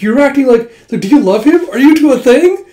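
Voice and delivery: high and loud, pressing for gossip; loud nosy squeal of a question; excited teasing. Retold or imitated. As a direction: badger her about her crush. Teasing friend about a crush.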